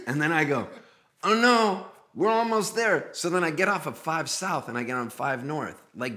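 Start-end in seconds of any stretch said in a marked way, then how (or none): 1.2-3.1 high, loud cover-up; mock reassurance. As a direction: brush off the worry in a raised, loud voice. Covering up being hopelessly lost.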